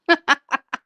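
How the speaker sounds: high-pitched run of chuckles; mocking laugh. Real, not acted. Ranter laughs at the person being mocked, mocking laughter. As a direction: laugh at their expense.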